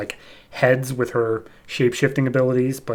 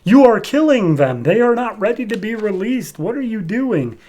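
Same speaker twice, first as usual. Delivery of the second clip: higher and louder, alarmed at the start; alarmed protest; 9 semitones higher, 4 dB louder than usual. Retold or imitated. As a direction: cry out in mock panic at the destruction. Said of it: "is like".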